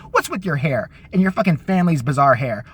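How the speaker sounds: normal talking voice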